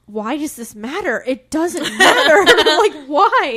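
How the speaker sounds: cracking up while ranting about a boring book; laughing exasperation. Retold or imitated. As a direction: laugh through a fed-up rant. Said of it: "I was like".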